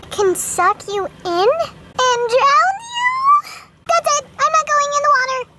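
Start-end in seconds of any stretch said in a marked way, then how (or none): 2.0-5.5 high, frightened little voice; suddenly scared of the ocean. Acted. Doll frightened by ocean warning.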